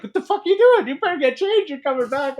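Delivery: very high-pitched scolding yell; mock angry boss. Pusher ordering a soaking worker to change, mock angry yelling. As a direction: imitate a boss chewing out a soaked worker.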